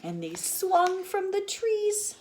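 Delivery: very high, slow; high playful. A narrator's reading. Teacher reading the rumpus, very high. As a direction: swing the words.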